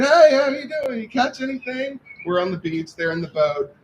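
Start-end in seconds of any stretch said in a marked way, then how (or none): 0.0-2.0 high, bright hail across the water; cheery call out. Retold or imitated. Calling from the beach to the boat, cheery hail. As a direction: call out to the boat, all innocent. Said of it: "we said".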